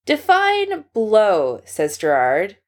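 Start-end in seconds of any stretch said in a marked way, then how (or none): 0.0-1.6 air quotes. A narrator's reading. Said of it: "Pete can practically hear the quote marks".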